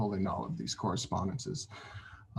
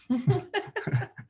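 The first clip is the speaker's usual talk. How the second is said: self-conscious laugh. Real, not acted. Laughs at own stumble.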